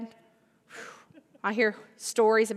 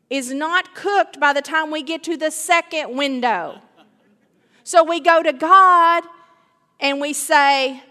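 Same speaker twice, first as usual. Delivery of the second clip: louder, higher, pressing fervor; preaching at full force; 5 semitones higher, 8 dB louder than usual. Acted. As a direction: drive it home from the pulpit.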